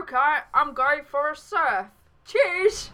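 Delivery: high, hammed-up Australian surfer; Aussie accent. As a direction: sign off in a broad put-on Aussie accent. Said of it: "should have done that in an Aussie accent".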